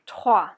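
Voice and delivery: loud "Trois" as "trwah", R from the throat; French "Trois", strong guttural R after the T. Shown as a demonstration. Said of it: "pronouncing that guttural R".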